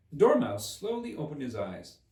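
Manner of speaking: usual speaking voice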